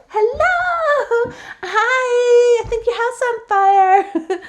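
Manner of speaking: loud high singsong greeting; overly polite alarm with a laugh; mock polite warning. Timid nice warning again.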